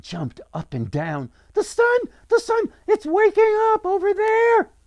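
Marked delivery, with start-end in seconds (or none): 1.5-4.7 put-on very high, loud, excited child voice; girl's shout. A narrator's reading. Girl alerts the crowd to the sun, high shout. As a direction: shout it like a little girl jumping up and down. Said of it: "the girl shouted".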